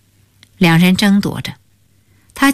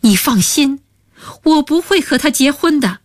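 urgent, higher-pitched reassurance; anxious raised voice; 7 semitones higher than usual. Acted. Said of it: "大声说".

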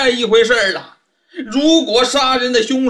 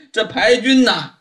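ordinary delivery

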